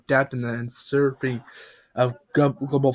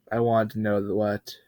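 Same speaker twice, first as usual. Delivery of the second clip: normal talking voice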